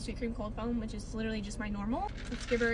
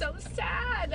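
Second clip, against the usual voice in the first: high, drawn-out mock wail; half-joking high wail of sadness; wailing so sad; 7 semitones higher than usual. Real, not acted. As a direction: cry it out like a mock lament. Wailing over closing store.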